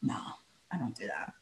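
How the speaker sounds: low, very soft denial; mumbled denial. Real, not acted. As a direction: mutter it under your breath.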